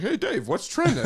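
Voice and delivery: high, laughing anchor imitation; mock the cheesy host, laughing; fake anchor voice. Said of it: "His fake anchor voice".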